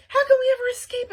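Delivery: high, loud, fast panic; panicked cry. Acted. Flytrap panics at the chasers, high, loud and fast. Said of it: "I cried".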